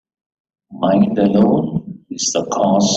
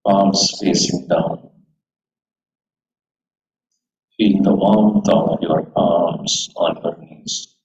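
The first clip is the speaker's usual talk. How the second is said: guided meditation. Acted. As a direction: guide them gently into stillness. Leads a meditation.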